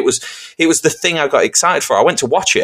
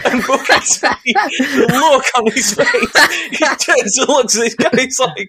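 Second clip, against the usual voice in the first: high, slow words through laughter; laughing while telling; 11 semitones higher, slower than usual. Real, not acted. Retelling the wrestling story, laughing through it. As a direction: tell it while still cracking up.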